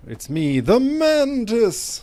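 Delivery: high, loud, slow proclamation; theatrical hero shout. Acted. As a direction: belt out a grand heroic entrance. Announcing hero persona loudly.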